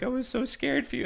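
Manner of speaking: high, quick imitation of a crying woman; small, high-pitched voice as if in tears; tearful. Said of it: "she just starts crying".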